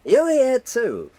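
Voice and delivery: surprised. Acted. Surprised greeting of a rival.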